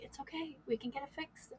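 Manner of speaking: very soft comforting husband; gentle, barely voiced consolation; retold reassurance. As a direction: hush your voice to soothe a crying wife. Retold husband comforting his crying wife.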